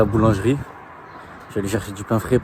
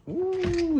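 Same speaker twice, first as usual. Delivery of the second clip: startled yelp; 5 dB quieter than usual. Real, not acted. Nearly hit the camera.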